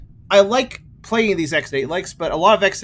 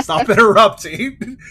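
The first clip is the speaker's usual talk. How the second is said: slow, laughing mock scolding; 6 semitones higher, 7 dB louder, slower than usual. Real, not acted. Teasing co-player, laughing.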